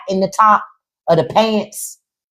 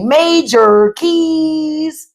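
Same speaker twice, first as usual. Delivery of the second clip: drawn out catchphrase; 8 semitones higher, much slower than usual. Retold or imitated. Quoting slang catchphrase.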